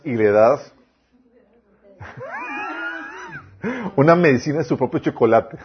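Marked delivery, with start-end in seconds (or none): 2.0-3.4 a shrill, hammed-up squeal of a laugh; mock angry outburst laugh. Pastor mimics someone flaring up at an insult, with a shrill laugh. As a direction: burst out in a high mock laugh-yell. Mocking how people flare up.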